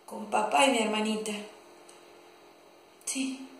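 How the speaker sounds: low, soft and halting; subdued, sorrowful remembering; wistful recollection. Acted. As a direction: recall a lost love with long pauses. Recalls last sight of lost love.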